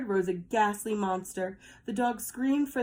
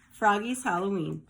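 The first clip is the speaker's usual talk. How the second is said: ordinary delivery, nothing marked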